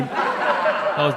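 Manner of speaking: ordinary talk, nothing marked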